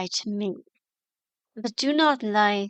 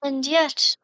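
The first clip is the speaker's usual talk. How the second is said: ordinary delivery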